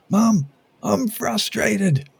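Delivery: high, slow, drawn-out child's yell, imitating a toddler; little girl yelling. Daughter yelling at Mum in the car park, high drawn-out child's cry. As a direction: belt it out like a frustrated toddler. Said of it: "she yells at".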